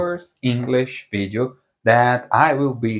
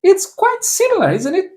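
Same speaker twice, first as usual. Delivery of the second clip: much higher, playful squeak; teasing high voice. Acted. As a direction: tease the learner in a high voice. Teasing the learner.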